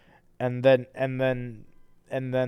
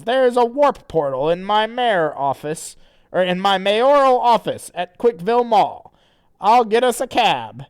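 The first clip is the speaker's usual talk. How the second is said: character voice; 9 semitones higher, 10 dB louder than usual. Acted. Reading Chris's lines in voice.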